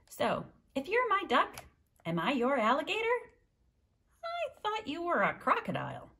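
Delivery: lively read-aloud with high character voices; storytime reading. A narrator's reading. Librarian reading to children, animated character voices. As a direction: read the duck story to the kids, bouncing between voices. Reads picture book with voices.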